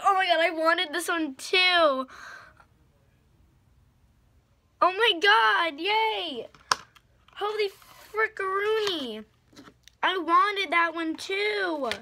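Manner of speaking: high, loud gushing delight; excited squealing. Real, not acted. Finding the shade she wanted, real high loud gushing. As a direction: gush over the shade you wanted.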